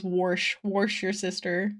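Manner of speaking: "Warsh" said with an added r, like "worsh"; friend's "warsh" pronunciation. Retold or imitated. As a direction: stretch out "warsh" slowly with a hard r. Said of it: "as one of my friends says".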